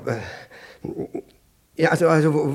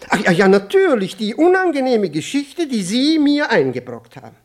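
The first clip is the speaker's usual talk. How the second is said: bursts out loud and high, suddenly remembering; a sudden loud flash of memory; sudden recollection; 8 semitones higher, 8 dB louder than usual. Acted. Official to Nowikow, suddenly recalling his blunder, loud and high.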